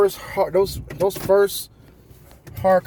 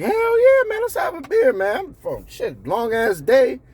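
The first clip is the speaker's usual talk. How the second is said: much higher, excited agreement; eager yes; 9 semitones higher than usual. Retold or imitated. Worker eagerly accepting a coworker's bar invite. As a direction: jump at the offer of a beer. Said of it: "your first instinct is like".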